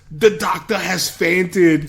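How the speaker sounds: mock Arnold line. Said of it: "But when he goes".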